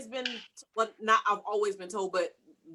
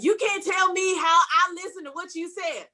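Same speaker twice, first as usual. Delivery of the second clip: indignant retort; 8 semitones higher than usual. Retold or imitated. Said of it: "And they're like".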